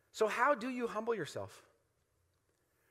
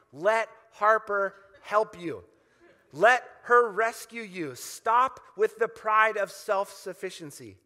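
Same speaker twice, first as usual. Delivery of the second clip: emphatic plea; 8 dB louder than usual. Acted. Urging drowning swimmer to submit.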